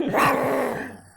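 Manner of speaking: loud playful mock roar; dinosaur voice. Mock roar answered, louder.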